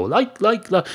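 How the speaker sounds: high-pitched parody of youth filler-speak; mock youngster. Mocking how young people speak, high imitation. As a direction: imitate a youngster stuck on one word. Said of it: "They go".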